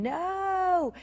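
a long mock cry held high; retold scream. Woman recalling her reaction to an overwhelming vision, retold scream. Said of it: "I started screaming".

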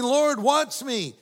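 high, slow eager plea; childlike plea. Retold or imitated. Believer calling to God like a child, eager and high. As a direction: ask like a child wanting to be seen. Believers like kids seeking attention.

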